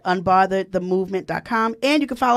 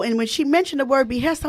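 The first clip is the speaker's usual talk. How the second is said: usual speaking voice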